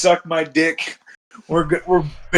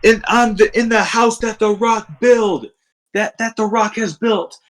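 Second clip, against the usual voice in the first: excited; 8 semitones higher than usual. Retold or imitated. Narrating Rock promo excitedly.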